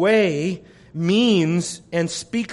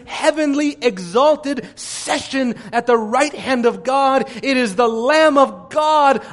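full-force preaching; 5 semitones higher than usual. Acted. Preacher to congregation, exalting the slain Lamb, fervent preaching. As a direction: proclaim it to the hall with conviction.

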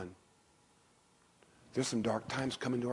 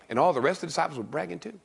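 ordinary talk

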